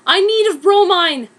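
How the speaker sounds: high, loud desperate cry; desperate yell. Acted. Harry shouting as the broom escapes. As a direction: call out after the broom.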